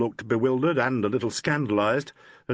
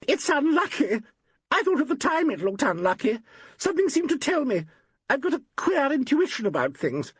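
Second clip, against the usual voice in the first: much higher, overwrought outburst; a nervous woman blurting out a superstition, high-pitched; high, agitated cry of foreboding; cried out. A narrator's reading. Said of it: "Valerie Gaines cried out".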